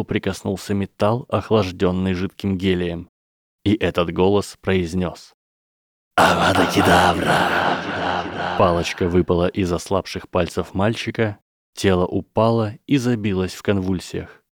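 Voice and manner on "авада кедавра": slow, drawn-out killing curse; cold dark lord voice. A narrator's reading. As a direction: draw each syllable out like a cold death sentence. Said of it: "резкий и пронзительный, как свисток чайника".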